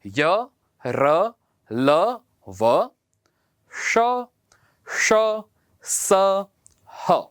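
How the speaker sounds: separated य र ल व श श स ह; teacher sounds out the last letters; consonant recital. Shown as a demonstration. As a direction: clear slow recital. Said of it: "वे हैं".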